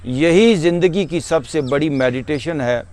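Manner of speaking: normal talking voice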